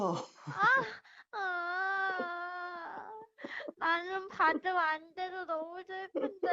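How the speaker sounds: whiny crying. Acted. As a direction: sulk and whimper like a child. Teased girlfriend whining tearfully.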